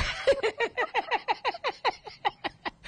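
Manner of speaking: a high, breathy run of laughter; high burst of laughter. Real, not acted. Telling don Juan about her homesick trip, a high burst of laughter.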